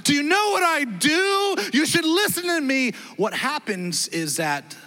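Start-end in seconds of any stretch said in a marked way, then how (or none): 0.0-2.9 entitled outburst. Retold or imitated. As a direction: puff up and demand respect. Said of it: "share our authority".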